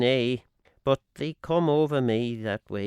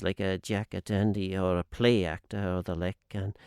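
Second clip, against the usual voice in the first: usual speaking voice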